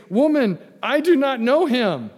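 loud, high-pitched protest; emphatic denial. Acted. Peter's denial, acted loudly.

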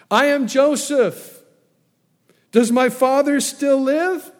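commanding cry, pitched high and loud; the ruler cries out to clear the room. Acted. Joseph cries out for everyone to leave, high and loud.

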